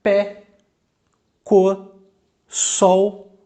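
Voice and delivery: pé, cor, sol as isolated single beats; one voice impulse each. Shown as a demonstration. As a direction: say pé, cor, sol one at a time, long pauses between. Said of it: "um impulso de voz".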